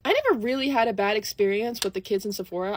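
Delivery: usual speaking voice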